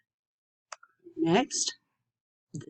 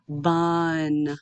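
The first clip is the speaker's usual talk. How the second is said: BUN drawn out slowly on a level pitch; stretched out. Shown as a demonstration. Teacher blending sounds for students, BUN slowly stretched out. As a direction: stretch BUN, holding vowel and n. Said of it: "say it slowly and stretched out".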